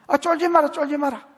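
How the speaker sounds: loud, high Gyeongsang dialect pep talk. Acted. God cheering up his frightened people in Gyeongsang dialect, loud and high. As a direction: bark it in Gyeongsang dialect like a gruff elder. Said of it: "경상도 말로".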